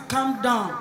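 soft, low soothing tone; calm reassurance. Retold or imitated. Rich man calming the angry driver, soft reassuring. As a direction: settle him down gently. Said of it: "The rich man say".

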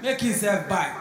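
ordinary delivery

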